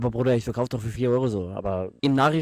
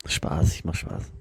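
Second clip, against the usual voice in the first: seductive whisper; 5 semitones lower, 4 dB quieter, much slower than usual. Acted. Teasing in put-on voice.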